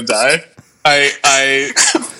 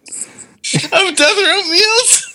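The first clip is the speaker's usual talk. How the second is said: laughing; much slower than usual. Real, not acted. Laughing while asking.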